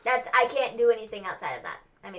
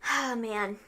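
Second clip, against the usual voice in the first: usual speaking voice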